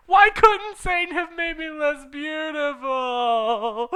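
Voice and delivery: high, loud, slow complaint; angry shout. Acted. Heroine cursing her beauty, high slow angry shout. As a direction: shout it in dramatic anger. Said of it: "I shouted angry".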